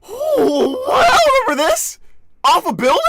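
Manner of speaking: very high, loud delighted shriek; excited squeal. Real, not acted. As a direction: squeal with sudden recognition. Sudden recognition on stream.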